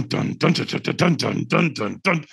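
vocal drum beat, rhythmic and percussive; mouthed drumming pattern; imitated African drummers. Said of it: "he's got African drummers".

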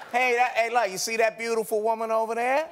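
New Orleans accent. Shown as a demonstration. Host teaches the actress, New Orleans accent. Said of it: "like, a New Orleans accent".

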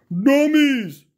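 loud, high yell of Dummies!; a scolding rider shouts from his horse; rider's yell. A narrator's reading. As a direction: bark it out at the fools. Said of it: "he yelled the rider".

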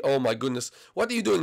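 ordinary delivery

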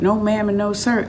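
ordinary delivery, nothing marked

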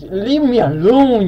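high, drawn-out slow complaint; exasperated plea. Real, not acted. Gamer to a pursuing player, high exasperated plea. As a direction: stretch it out as a pestered whine.

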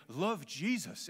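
put-on slow, weighty Welsh accent. Preacher imitating his Welsh seminary mentor, Welsh accent. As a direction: draw it out like a profound Welshman. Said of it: "it comes with a Welsh accent".

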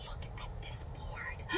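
hushed awed whisper of Look at this board. Real, not acted. Showing viewers the gift board, in an awed whisper. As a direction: breathe it out in wonder.

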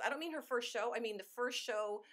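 ordinary delivery

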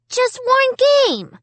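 high, drawn-out begging; pleading. A narrator's reading. Little brother begging for a game, pleading. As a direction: beg hard in a high little-brother whine. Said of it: "he pleaded".

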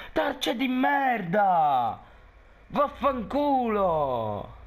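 slow, drawn-out furious curses; angry cursing. Real, not acted. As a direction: spit out each curse slowly. Raging after death.

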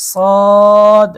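সদ held loud on a long rounded o; three-alif stretch. Shown as a demonstration. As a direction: stretch the vowel of সদ for three alifs, loud and even. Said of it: "তিন আলিফ টান". (সদ জোরে তিন আলিফ টেনে)

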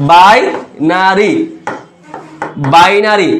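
Binary stretched very slowly; slowly drawn out. Shown as a demonstration. Tutor to students, drawing out binary slowly. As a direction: drag out binary to point at বাই. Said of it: "বাই শব্দ দ্বারা ভাইয়া দুই বুঝায়".

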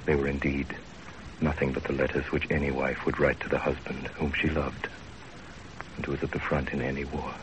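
low, even storytelling voice; story narration. A narrator's reading. First-person narration of the story.

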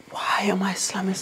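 slow whispered aside to himself. Real, not acted. Cooking alone on camera, whispering to himself. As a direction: hush it like you're embarrassed.

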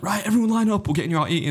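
imitated high-pitched round-up call; organiser's call.

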